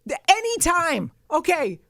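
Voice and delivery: high, loud, exasperated rant; heated rant. Real, not acted. Ranting at the network about the recording schedule, exasperated. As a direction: vent about the schedule, building up. Ranting at Nick, half laughing.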